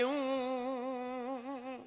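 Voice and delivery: drawn-out mock whimpering of little kids; slow, stretched childish boo-hoo; mock children's wailing. Patel's grandsons crying for the bhagvat, mock slow wailing. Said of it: "सो दोनों रोने लगे".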